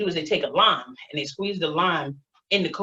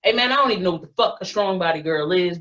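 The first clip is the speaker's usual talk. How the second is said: ordinary talk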